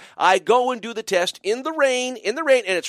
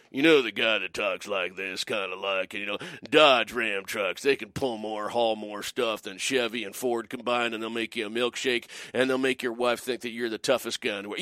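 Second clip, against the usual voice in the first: truck commercial narrator; 10 semitones lower, 7 dB quieter than usual. Acted. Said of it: "You know the guy talks like that?".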